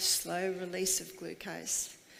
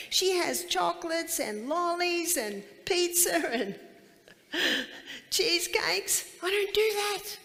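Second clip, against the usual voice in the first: excited retelling; 8 semitones higher, 4 dB louder than usual. Retold or imitated. Lady marvels at neighbour's treats.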